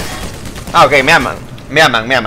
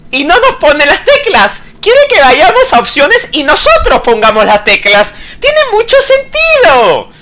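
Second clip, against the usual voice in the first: mock-earnest, rising higher, slowing at the end; sarcastic; 7 semitones higher than usual. Real, not acted. Gamer mocking the creator for hiding the keys, sarcastic. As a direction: drip sarcasm on the last phrase.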